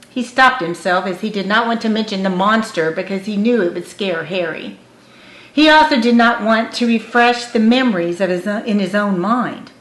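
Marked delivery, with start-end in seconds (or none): none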